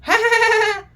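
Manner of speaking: high, put-on giggling cackle; mock high-pitched laugh; imitated cackle. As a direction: mimic her mocking laugh. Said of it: "ऐसे करके हंसती रहती है".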